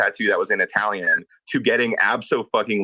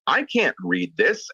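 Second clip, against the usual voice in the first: puzzled retold line; 7 semitones higher, slower than usual. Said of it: "I said".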